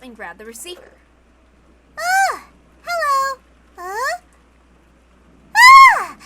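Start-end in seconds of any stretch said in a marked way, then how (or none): 2.0-6.2 high, sudden yelps of shock; startled shrieks. Acted. Maria picks up the phone and shrieks in shock. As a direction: jolt into a scream.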